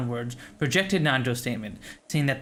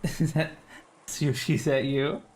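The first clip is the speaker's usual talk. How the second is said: normal talking voice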